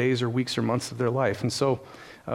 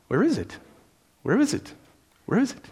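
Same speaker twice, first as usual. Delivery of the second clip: put-on high, rising little-child voice; imitating the baby; 9 semitones higher than usual. Father imitating the baby asking for his pacifier, high and childlike. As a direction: pipe it up like a sleepy toddler.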